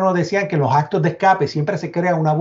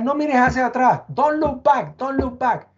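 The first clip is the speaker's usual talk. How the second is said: higher mock yell of a warning; retold police yell; 8 semitones higher than usual. Police calling to him across the street, urgent higher yell. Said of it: "me empiezan a gritar".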